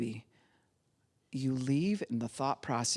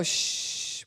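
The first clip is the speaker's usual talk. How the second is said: single unvoiced shh; soft shushing. Shown as a demonstration. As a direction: shush the baby softly. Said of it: "We go".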